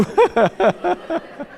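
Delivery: rising amused laugh; high laugh. Real, not acted. Preacher laughing about his wife, high laugh.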